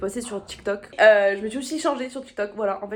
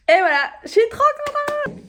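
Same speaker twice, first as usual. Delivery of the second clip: excited squeal; 8 dB louder than usual. Real, not acted. Squeals about concert outfit.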